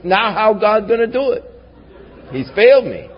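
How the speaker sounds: high-pitched, hammed-up worry; mock panic. Preacher acting out his own panic over a lost sweepstakes, mock panic. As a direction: play at panicking, pitch jumping up. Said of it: "that's when I really panic".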